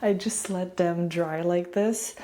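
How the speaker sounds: ordinary delivery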